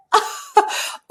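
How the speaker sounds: high airy giggle of delight; breathy giggle. Real, not acted. Remembering painting her car, a high breathy giggle. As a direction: giggle at your own excitement.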